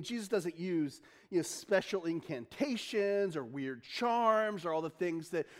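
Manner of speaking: usual speaking voice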